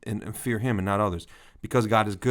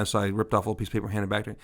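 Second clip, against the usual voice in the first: ordinary talk, nothing marked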